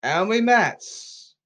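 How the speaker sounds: loud, high, drawn-out shout; slow, high, emotional yell; emotional call-out. Real, not acted. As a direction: shout the team name slowly, as an accusation. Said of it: "I get very emotional here".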